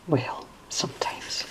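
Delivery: soft whispered aside. Real, not acted. Aside on an anger quote, whispered. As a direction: breathe it under your breath.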